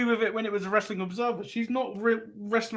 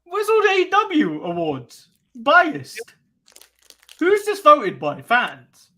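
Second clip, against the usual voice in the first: mock outrage; 5 semitones higher, 5 dB louder, slower than usual. Mocking biased awards complaint.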